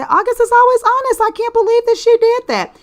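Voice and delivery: imitating listeners' outrage; mock shocked listener. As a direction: play the scandalised fan. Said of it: "y'all say".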